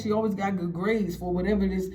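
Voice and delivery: usual speaking voice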